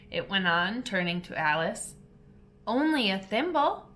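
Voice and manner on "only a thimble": sadly. A narrator's reading. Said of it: "Alice said sadly".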